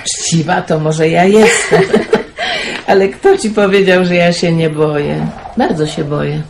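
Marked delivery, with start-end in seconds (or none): 1.9-2.8 very high, short rising laugh; amused squeal of laughter. Acted. The aunt laughs off the praise with a high amused giggle. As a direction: let a giggle escape.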